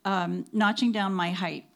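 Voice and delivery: ordinary delivery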